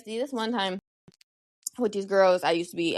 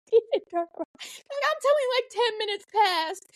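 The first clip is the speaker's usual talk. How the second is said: high outraged; 13 semitones higher than usual. Real, not acted. Outraged retelling.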